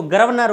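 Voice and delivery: गर्वनर with the r jumped forward, "gar-av-nar"; mispronounced. Shown as a demonstration. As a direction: loudly show the slip in गर्वनर, r straight after "ga". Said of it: "गर्वनर नहीं होता मेरे दोस्तों". (गलत उच्चारण "गर्वनर", र पहले)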